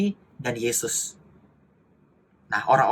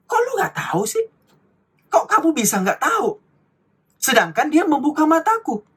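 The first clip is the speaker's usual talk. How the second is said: incredulous retort; 7 semitones higher than usual. Retold or imitated. Preacher plays the blind man scolding the Pharisees, high and indignant. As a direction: throw their ignorance back at them. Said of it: "Jadi orang buta ini menjawab lagi".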